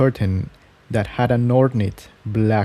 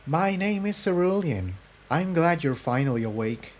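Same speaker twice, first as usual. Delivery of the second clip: higher, much softer, gently reassuring; 5 semitones higher, 8 dB quieter than usual. A narrator's reading. Cerulean greets the waking pony, soft and reassuring. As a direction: soothe a frightened stranger softly. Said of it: "Her voice was very reassuring".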